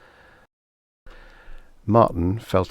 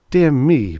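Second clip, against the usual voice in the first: mock innocent; 8 semitones higher, 7 dB louder than usual. A narrator's reading. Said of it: "Martin said innocently".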